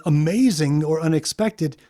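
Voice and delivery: usual speaking voice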